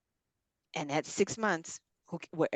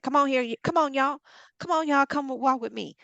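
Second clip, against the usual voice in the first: Abraham calling people; 6 semitones higher than usual. Acted. Abraham calling his people along.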